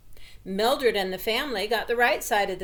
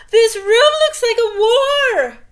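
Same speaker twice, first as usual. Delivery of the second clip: much higher, very loud, near-crying shriek; high loud distraught mother cry; mother shouting; 13 semitones higher, 14 dB louder than usual. A narrator's reading. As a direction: wail it like a horrified mom seeing the mess.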